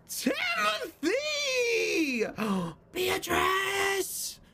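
gasping, very high drawn-out cries; drunk's amazed cry. Acted. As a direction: call out like a drunk spotting long-lost friends. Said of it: "he looks up, he's like".

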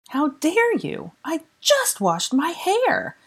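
high, slow indignant shriek. Acted. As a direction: shriek it out, outraged and drawn out. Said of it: "shrieked Granger".

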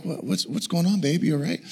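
soft bedside check-in; quiet husband checking on his wife; hushed concern. Retold or imitated. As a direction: ask softly so as not to startle her. Checking on sleeping wife at night.

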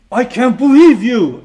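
high, loud, drawn-out indignation; acted outraged incredulity; crowd's disbelief. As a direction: act appalled at one man. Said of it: "They're saying to him".

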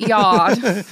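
loud mock officer; hammed-up impression, loud; German police guy. Co-host finishing the police guy's line, hammed-up. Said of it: "like, the German police guy".

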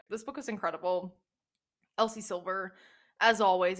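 normal talking voice

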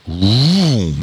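drawn-out rising and falling vroom; long engine swoop; imitated car engine. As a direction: mimic a car pulling away.

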